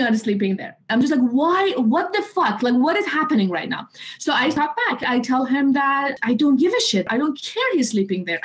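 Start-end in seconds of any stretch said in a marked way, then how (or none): 1.4-3.9 high, incredulous mock outrage; incredulous outburst. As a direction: throw up your hands in disbelief. Retold outburst at her father.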